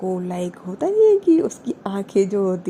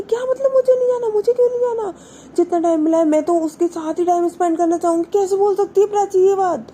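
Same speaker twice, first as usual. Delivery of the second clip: much higher, louder furious rant, fast; 9 semitones higher, 4 dB louder than usual. A narrator's reading. Wani rants to Tanu about Prachi, furious and much higher. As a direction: rage at her friend over the girlfriend. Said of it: "बहुत ज़्यादा गुस्सा होती है".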